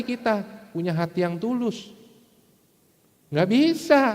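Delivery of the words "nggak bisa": high, loud "can't be done"; loud, insistent refusal; emphatic denial. Real, not acted. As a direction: answer your own question, voice pitched way up. Emphatically answers own rhetorical question.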